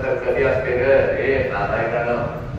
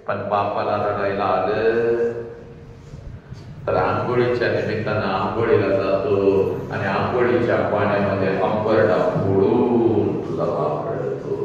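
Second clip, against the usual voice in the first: sorrowful preaching; 4 semitones lower than usual. Real, not acted. Speaker revealing father weeping while bathing, sorrowful preaching.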